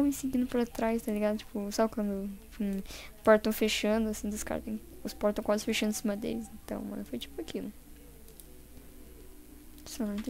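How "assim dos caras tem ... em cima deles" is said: usual speaking voice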